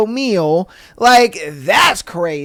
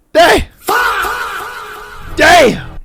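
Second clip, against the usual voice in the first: stunned outburst; 12 semitones higher, much slower than usual. Real, not acted. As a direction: blurt out real shock, drawing out the swear. Stunned reaction to the story's twist.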